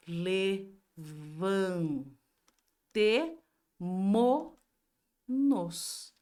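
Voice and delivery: Levantemo-nos stretched very slowly, each syllable le-van-te-mo-nos spelled out; drawn-out demo. Shown as a demonstration. Teacher showing pupils the correct form, slowly drawn out. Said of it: "Como é que fica?".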